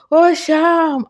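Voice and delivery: put-on high wailing cry of an old lady; hammed-up tearful wail; mock weeping. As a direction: cry out his name in mock distress. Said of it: "అంటూ ఏడుస్తూ ఇంటావిడ".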